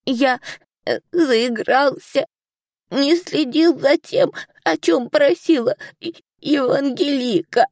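sorry little dragon, hiccuping between words; high, slow, hiccuping apology. A narrator's reading. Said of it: "икая сокрушался Поль".

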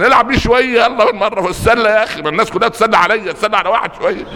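high, playful teasing inner voice; mischievous aside. Retold or imitated. Sheikh thinks of toying with the scared passenger, playful and high. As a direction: tease like you're plotting a prank.

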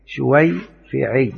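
شويفعي drawn out very slowly, each syllable held; slow spelled-out diminutive. Shown as a demonstration. Teacher answering own question to students, dictating slowly. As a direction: stretch شويفعي out for the class. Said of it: "وشافعي أقول إيه؟".

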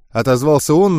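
usual speaking voice

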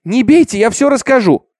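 high, loud desperate promise; crying out, pleading. A narrator's reading. Girl promises to confess to stop the beating, crying out. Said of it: "закричала она".

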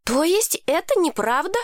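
high-pitched astonished question; a touch of wonder, pitched well up; mildly surprised. A narrator's reading. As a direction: ask it in lightly acted disbelief. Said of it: "удивилась Лоуи".

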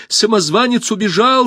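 high, loud shout of alarm. A narrator's reading. Pursuer bursting into the hall with news, high shout. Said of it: "закричал он".

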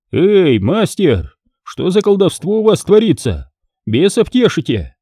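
a stern monk calling out. Acted. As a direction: confront him like a suspicious monk. Monk confronts smith.

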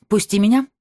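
loud, hard order; harsh command. A narrator's reading. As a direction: bark it firmly. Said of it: "жёстко сказала она".

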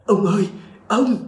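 restrained, high-pitched narrated cry; screaming for help. A narrator's reading. Grandchild crawling inside calls to grandfather, mildly acted scream. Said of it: "gào thét".

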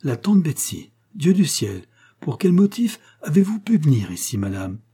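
high, loud astonished outburst; shocked exclamation. A narrator's reading. Widow suddenly recognises the aunt, shocked exclamation. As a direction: exclaim in indignant disbelief.

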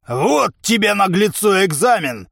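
biting, emphatic retort; caustic. A narrator's reading. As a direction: put the cheeky one in his place. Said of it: "язвительно заметил овцебык".

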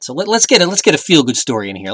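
normal talking voice

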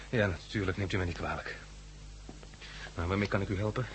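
abashed. Acted. Embarrassed apology to blind man.

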